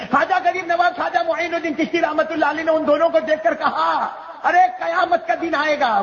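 very high, sustained declamation; full-force preaching. Acted. Preacher warning the congregation of Judgement Day, fervent declamation.

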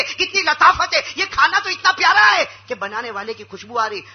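loud, high, over-the-top praise of the food; husband hams up his praise to win his wife a blessing; gushing husband. Retold or imitated. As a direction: rave about every bite as if it's heaven. Husband gushes over the biryani.

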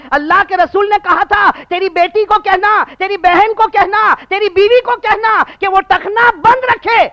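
high, loud, insistent sermon shout; full-force preaching. Acted. Preacher quoting the Prophet's command to men, full force. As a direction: hammer each command out fervently.

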